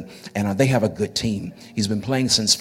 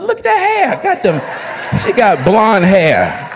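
laughing; 9 semitones higher, 9 dB louder, slower than usual. Real, not acted. Teasing a mother's hair.

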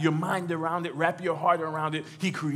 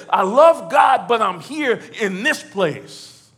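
loud, higher, emphatic cry; troubled protest; 5 semitones higher, 10 dB louder, slower than usual. Acted. Churchgoer with questions for God, troubled protest. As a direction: protest it, stretching the last words slowly. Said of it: "that has questions that".

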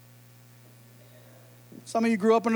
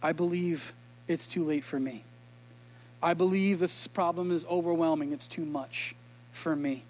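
soft, low, giving up; despairing self-talk; 6 semitones lower, 8 dB quieter than usual. Retold or imitated. Pastor voicing the congregation's despair, soft and defeated. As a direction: murmur it like you've lost hope. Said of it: "said that to your own self".

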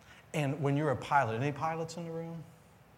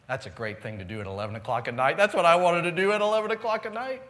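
mock enthusiasm; 8 dB louder, faster than usual. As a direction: build into an exasperated, sarcastic cry. Sarcastic gripe about late pickup.

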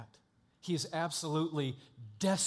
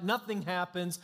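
ordinary delivery

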